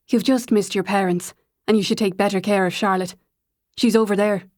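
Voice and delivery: a light hint of a lilting Irish accent. A narrator's reading. Hugo telling Sophie about her parents, in a light Irish lilt. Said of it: "spoke in a lilting Irish accent".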